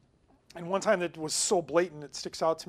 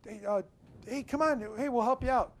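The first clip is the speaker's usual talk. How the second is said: mild mock yell, higher pitched; retold yelling; 5 semitones higher than usual. Friends calling to the bleeding man, a mild retold yell. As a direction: call after him without real volume. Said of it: "my friends and I were yelling".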